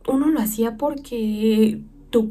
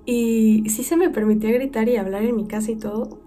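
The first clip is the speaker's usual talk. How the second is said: normal talking voice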